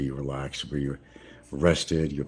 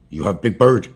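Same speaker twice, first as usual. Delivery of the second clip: higher, louder broken English with a put-on local accent; 4 semitones higher, 10 dB louder than usual. Asking a local shopkeeper for a chicken in a put-on local accent. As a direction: mimic the local accent to a store clerk. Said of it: "gotta put that accent on".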